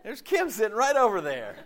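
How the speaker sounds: happy surprise, with a laugh. Retold or imitated. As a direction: laugh as you spot an old friend. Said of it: "I'm like".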